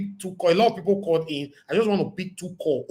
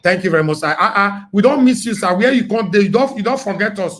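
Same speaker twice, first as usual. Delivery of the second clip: excited greeting; 10 dB louder than usual. Real, not acted.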